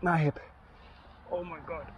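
tripping and falling. Real, not acted. Pain after falling off bike.

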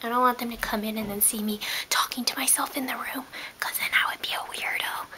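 hushed unvoiced whisper. Real, not acted. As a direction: whisper so nobody outside hears. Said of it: "Someone's coming".

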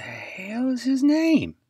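high, slow, drawn-out frustration; exasperated. Real, not acted. Host forgetting an announcer's name, high slow exasperation. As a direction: strain to remember, fed up.

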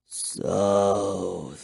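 low, soft, drawn-out vampire voice; slow, hushed undead murmur. A narrator's reading. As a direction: murmur it deep and hungrily. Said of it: "he murmured".

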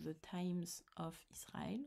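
whispered "The Times of Israel" in a jokey English accent; mock English accent. Shown as a demonstration. Vlogger jokingly whispers the title in her English accent. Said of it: "avec mon super accent".

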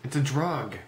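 ordinary delivery

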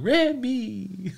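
Remy squeaked high through a laugh. Shown as a demonstration. Streamer laughing at his own French Remy. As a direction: crack up while saying Remy. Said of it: "I sounded like I was falling".

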